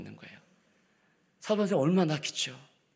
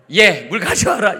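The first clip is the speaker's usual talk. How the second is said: loud, higher barked order; gruff command; 7 semitones higher, 11 dB louder than usual. Shown as a demonstration. Father-in-law ordering daughter-in-law, gruff command. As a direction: order her around sharply. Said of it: "이게 아니고".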